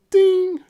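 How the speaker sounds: high, loud sung ding; ping it like a page-turn bell; bright mock chime, loud and high. Imitating the book's page chime.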